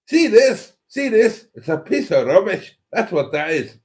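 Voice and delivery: high, loud Scottish rant holding up the phone; outraged Scottish bloke shoving the phone at you; mock Scottish outrage. Host playing Cheeseburger confronting the ombudsman, in a hammed-up Scottish voice. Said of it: "I don't know why Cheeseburger is suddenly Scottish".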